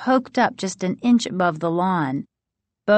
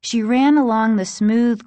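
plain reading voice

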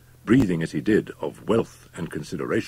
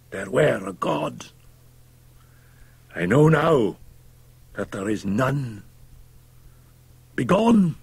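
anguished, denouncing Fettes; 9 semitones higher than usual. A narrator's reading. Fettes banishing the doctor, impassioned denunciation. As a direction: denounce him at high pitch, ending on an outcry.

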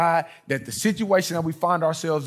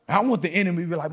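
usual speaking voice

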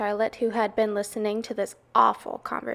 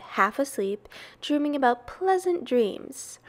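ordinary delivery

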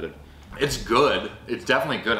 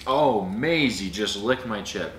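ordinary delivery

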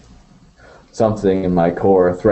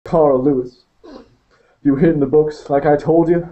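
lightly voiced young inmate, higher; a hint of a grieving young man, higher; Maurice talking; 6 semitones higher than usual. Maurice talks in the van.

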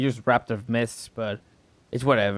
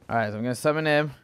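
ordinary talk

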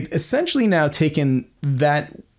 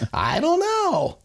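slow, much higher stammering Woody Allen try; a halting attempt at Woody Allen's voice; Woody Allen impression; slower than usual. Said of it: "I don't have a good Woody Allen impersonation".

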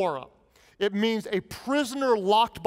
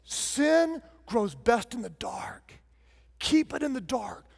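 slow unvoiced conspiratorial whisper; mock sinister whisper; 2 semitones higher than usual. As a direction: breathe it like a dark secret. Sarcastic advice to hide sin.

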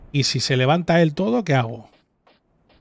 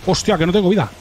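panicking gamer; 6 semitones higher, 6 dB louder than usual. Real, not acted. Low health mid boss fight.

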